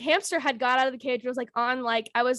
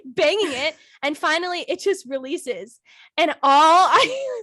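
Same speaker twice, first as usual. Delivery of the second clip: ordinary delivery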